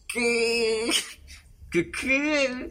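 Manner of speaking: drawn-out, incredulous "what?"; shocked disbelief. Acted. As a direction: squeal it in mock inner outrage. Acted inner shock at her confession.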